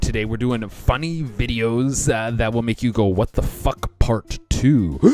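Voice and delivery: loud, punchy YouTube host opening; hyped show intro. Acted. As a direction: pump them up like a channel host.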